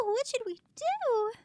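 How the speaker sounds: high tiny creature voice, falling end; little minion asks, worried; squeaky minion voice. Acted. Voicing small minion.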